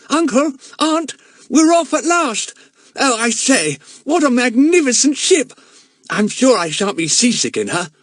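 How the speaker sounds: put-on high, loud, eager young voice; excited boy. Gangly boy arriving on ship, excited high voice. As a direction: gush with delight at the ship.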